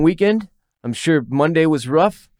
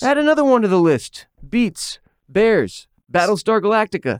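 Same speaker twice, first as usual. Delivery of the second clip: higher, earnest list in a Dwight Schrute voice; Dwight Schrute impression; 9 semitones higher than usual.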